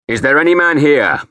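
loud, high challenge to the crowd; shouting. A narrator's reading. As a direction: shout it across the chapel. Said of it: "I shouted".

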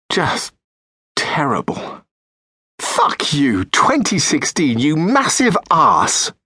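ordinary delivery

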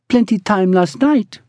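put-on high, sulky accented woman; sulking. A narrator's reading. Ruby reproaching Brigg for last night, sulking. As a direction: sulk it out, pitched high. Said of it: "she sulked".

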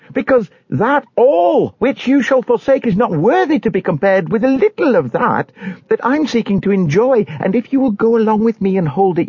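high-pitched, fervent warning; impassioned high-voiced pilgrim. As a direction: plead with your neighbours in a high, urgent voice.

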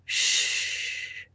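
very soft, drawn-out hush; soothing. A narrator's reading. Husband stroking his crying wife's hair, soothing. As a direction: hush her gently. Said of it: "he soothed".

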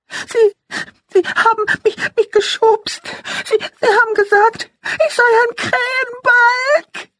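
put-on high, halting little girl sobbing; sobbing child. A narrator's reading. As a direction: stammer it out through tears. Sobbing six-year-old girl speaks.

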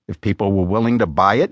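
usual speaking voice

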